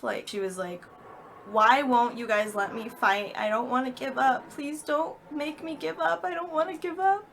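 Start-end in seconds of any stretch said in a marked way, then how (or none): 1.5-7.3 upset pleading. Retold or imitated. Mom pleading with her doctors to keep fighting, upset. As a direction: voice climbs high into a strained plea. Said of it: "getting really pissed off".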